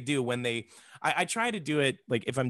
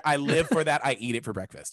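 ordinary talk, nothing marked

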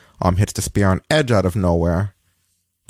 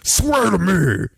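slow, higher hammed-up vigilante line; 10 semitones higher, much slower than usual. Host answering his co-host with a mock Batman line. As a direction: lean into a brooding superhero imitation.

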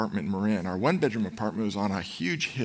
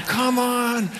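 much higher and louder cheering wife; wife cheering loudly; 13 semitones higher, 5 dB louder than usual. Retold or imitated. Pregnant wife yelling encouragement to her husband, high loud cheer. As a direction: shout him up the hill, bouncing with excitement. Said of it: "and just going".

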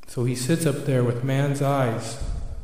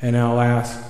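ordinary delivery

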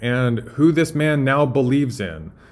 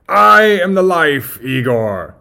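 loud, high, drawn-out proclamation; Doctor Frankenstein; 6 semitones higher, 9 dB louder, slower than usual. Acted. Doctor corrects Igor, loud and grandiose. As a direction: bellow it like a mad scientist. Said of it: "starting with Doctor Frankenstein".

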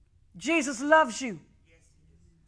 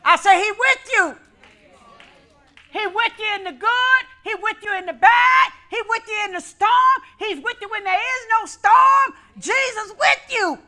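preaching at full force; 7 semitones higher than usual. Real, not acted. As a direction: lift the church with each line.

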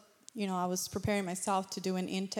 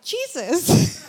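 much higher and louder, beaming; triumphant; 9 semitones higher, 11 dB louder than usual. Retold or imitated. Telling the client who healed him, high loud joyful answer. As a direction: ring out the name with joy.

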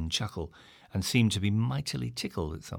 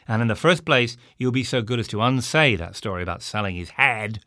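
loud, firm legal rebuke; loud indignant lecture; stern demand; 8 dB louder than usual. A narrator's reading. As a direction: lay down the law, louder and louder. Stern ultimatum to landlord.

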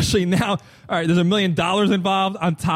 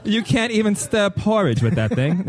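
higher-pitched bad British accent, amused; Simon Cowell; 4 semitones higher than usual. Judge dismissing a contestant, horrible British accent with a laugh. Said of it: "My horrible British accent".

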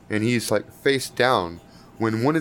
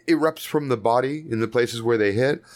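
usual speaking voice

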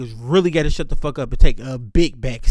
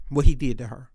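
normal talking voice

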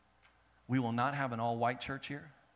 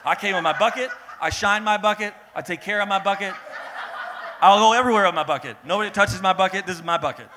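loud, higher, possessive boasting; proud bucket owner; 5 semitones higher, 11 dB louder, faster than usual. Acted. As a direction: brag about your bucket like a treasure. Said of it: "a lot of us came to church like this".

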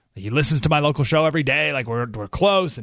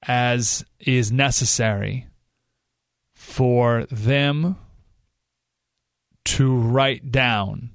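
drawn-out words with long pauses between; deliberately very slow; 4 semitones lower, much slower than usual. Shown as a demonstration. Host showing how he talks to reporters, very slowly. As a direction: spell it out at dictation pace, lower as it goes. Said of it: "like, literally this".

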